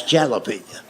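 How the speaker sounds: "jalapeno" with the J sounded as in jam; low, matter-of-fact "jalapeno" starting like "jal"; cousin's mispronunciation. Retold or imitated. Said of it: "That's a J".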